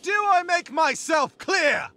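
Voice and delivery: slow, high-pitched rallying yell; commanding shout. Acted. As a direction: demand the troops' answer, drawing out each word. Commander demands assent from troops.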